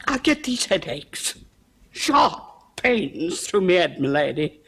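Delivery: slow, with a choked laugh; tearful. Acted. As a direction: drag the words out near tears. Cook confesses headaches, near tears.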